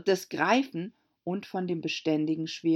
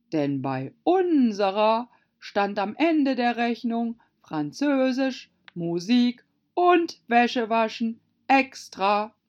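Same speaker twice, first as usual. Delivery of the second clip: slow, higher, loud emphasis; smug Mock Turtle; 6 semitones higher, 6 dB louder than usual. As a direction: list each item slowly and proudly. Voices Mock Turtle in dialogue. (langsame, gewichtige Schildkröte)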